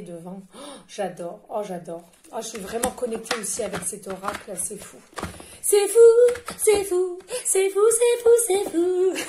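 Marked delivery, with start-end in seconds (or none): 5.6-9.1 high, loud, giddy squeals of delight; gushing, squealing over the deck. Acted. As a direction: squeal it out like a thrilled fan.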